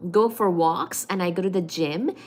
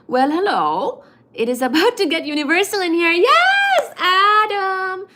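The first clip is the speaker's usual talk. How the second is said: rising to a loud, high, excited cry; excited greeting; 10 semitones higher, 7 dB louder than usual. Real, not acted. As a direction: greet him like a long-lost friend.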